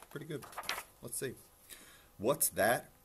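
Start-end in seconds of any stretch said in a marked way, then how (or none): 2.2-2.9 loud, raised gruff interruption; growled challenge. A narrator's reading. Unseen bear challenging the bragging rabbit, growled. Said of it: "growled a voice that was terribly gruff".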